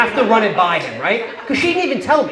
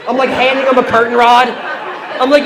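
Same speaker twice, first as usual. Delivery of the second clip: normal talking voice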